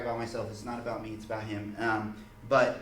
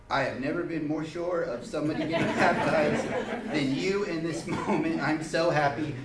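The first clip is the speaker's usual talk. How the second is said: joyful reply breaking into a laugh; louder, laughing, overjoyed; beaming laugh through the words; 4 semitones higher, 5 dB louder than usual. Retold or imitated. Joyful reply at baptism request.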